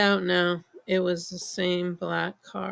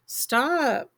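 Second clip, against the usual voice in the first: shouted stop; 6 semitones higher than usual. A narrator's reading. Slapping the car, demanding it stop.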